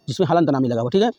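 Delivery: ordinary talk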